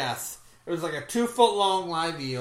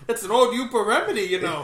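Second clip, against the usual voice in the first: high, quick mock Yooper voice; Yooper accent; 6 semitones higher, 6 dB louder, faster than usual. Yooper recommending an old remedy, light mock Yooper accent. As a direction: explain it as a folksy Yooper. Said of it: "old Yupa remedy".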